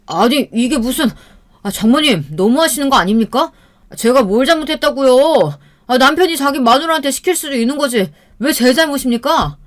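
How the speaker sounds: high-pitched, defensive protest; acted husband's aggrieved outburst; indignant yelling. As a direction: argue back hotly at a mother-in-law. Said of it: "어머니에게 소리를 치고 나갔습니다".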